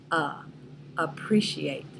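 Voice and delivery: "appreciate" with a reduced schwa "a" first; schwa demo. Shown as a demonstration. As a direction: isolate the "a" then say "appreciate". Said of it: "the schwa is in the first syllable".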